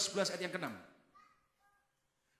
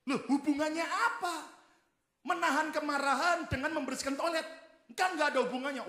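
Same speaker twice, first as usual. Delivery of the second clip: wife's incredulous protest; 7 semitones higher, faster than usual. Acted. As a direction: protest like a baffled wife. Wife voiced protesting in joke.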